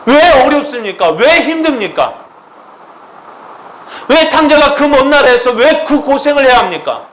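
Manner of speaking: high, loud pleading questions; impassioned preaching questions. Acted. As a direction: hammer each why at the congregation. Rhetorical questions shouted. (높고 크게 묻는 설교)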